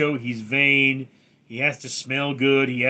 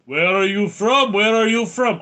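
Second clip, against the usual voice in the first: loud, higher mock-Russian accent; Soviet Russian accent; 6 semitones higher, 6 dB louder than usual. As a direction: belt it out in a put-on Russian accent. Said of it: "Soviet Union accent made".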